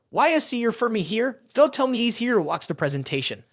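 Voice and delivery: ordinary delivery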